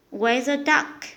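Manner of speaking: ordinary delivery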